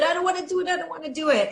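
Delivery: put-on high, whining kid voice; sulky child whining no; whiny child. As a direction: play a stubborn child refusing. Child refusing, voiced by teacher.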